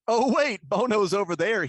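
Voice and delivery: high, loud surprised outburst; sudden realisation. Retold or imitated. Announcer finding Bono away from the pile, surprised. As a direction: blurt it like you just spotted him. Mimicking camera discovering the runner.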